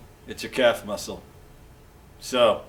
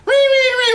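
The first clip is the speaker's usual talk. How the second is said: very high, loud mock fox squeal; fox call; 10 dB louder than usual. Mem Fox answers what the fox says, shrieked fox call. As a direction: squeal a piercing, silly fox cry. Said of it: "what does the fox say".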